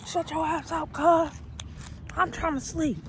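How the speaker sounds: high, irritated jailhouse telling-off; a lightly acted inmate shutting him up; annoyed snap. Telling the screaming man to shut up, high irritated snap. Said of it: "like, if I was in jail".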